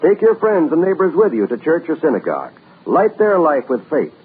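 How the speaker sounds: normal talking voice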